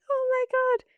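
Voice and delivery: high squeal of amazement; a mother astonished by the coincidence; retold amazement. Mother learns same school.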